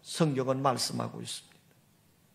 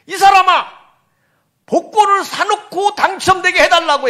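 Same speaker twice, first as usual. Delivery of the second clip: booming, higher, exasperated scolding; loud rebuke; 8 semitones higher, 6 dB louder than usual. Retold or imitated. God scolds the rabbi who never bought a ticket, loud and exasperated. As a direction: scold him like a fed-up God. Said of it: "큰 소리로 이렇게 책망하더랍니다".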